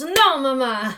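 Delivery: put-on Italian son voice; Italian accent. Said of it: "And he goes".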